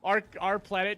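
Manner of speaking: ordinary talk, nothing marked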